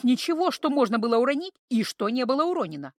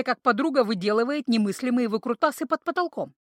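ordinary delivery

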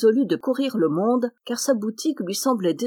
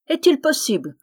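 high, loud outraged exclamation; indignant cry; 7 semitones higher, 4 dB louder than usual. Acted. Tailor answering the giant's insult, indignant cry. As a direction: feign shock at the insult. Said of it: "s'écria le petit tailleur".

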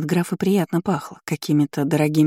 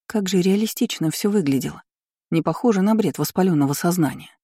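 plain reading voice

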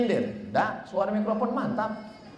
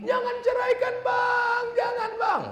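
pleading wife. Acted. Wife begging her husband not to divorce her, high shrill plea. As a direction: beg him not to leave, voice way up high.

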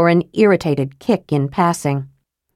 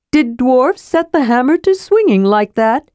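higher, eager question; curious kender; 6 semitones higher than usual. Acted. Kender to dwarf at glass, higher curious. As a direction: ask with wide-eyed curiosity. Narrator voices Tass.

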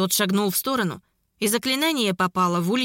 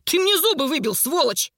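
higher-pitched, outraged complaint; indignant, raised-pitch outburst; cursing angrily; 6 semitones higher than usual. A narrator's reading. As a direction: swear at him over the injury. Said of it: "выругался Джет".

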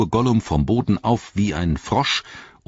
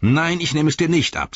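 higher, firm refusal; lightly voiced Frodo, standing firm; 11 semitones higher, 4 dB louder than usual. A narrator's reading. Frodo refusing to untie the wailing Gollum, firm.

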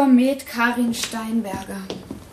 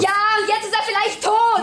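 higher, frantic accusation; panicked outburst; 7 semitones higher than usual. Acted. Accomplice blaming partner, panicked cry. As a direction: blurt it out in panic.

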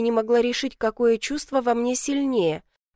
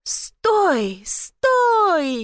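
drawn-out cry, climbing much higher; calling out; 9 semitones higher than usual. A narrator's reading. Girl calling after runaway goat, high urgent cry.

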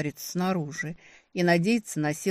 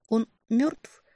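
slurring the words; tongue barely obeying; slurred tongue; 6 semitones higher than usual. Acted. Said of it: "заплетающимся языком спросила я".

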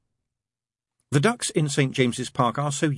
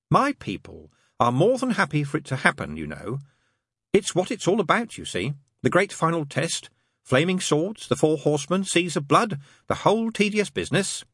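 higher-pitched angel character, reciting wearily; lightly voiced angel; 9 semitones higher than usual. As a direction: explain it as a proper angel.

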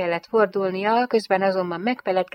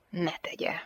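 hushed, firm reply; stern refusal; 9 dB quieter than usual. A narrator's reading. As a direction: forbid it quietly and curtly. Stern man answers her.

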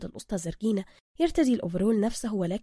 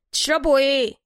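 higher, louder workshop boss; gruff master mechanic; 4 semitones higher, 8 dB louder than usual. Acted. Zergina greeting Soka in his workshop, higher and louder. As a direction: greet him like you own the place.

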